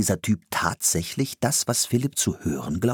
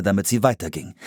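plain reading voice

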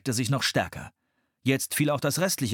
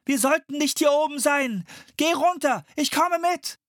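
much higher, louder, urgent; shouting; 4 dB louder than usual. A narrator's reading. Philipp shouts to Anne to go below. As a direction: keep yelling over the wind.

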